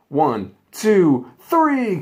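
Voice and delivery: loud count, pitch climbing to a high three; referee count. Acted. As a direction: count the pin like a ref.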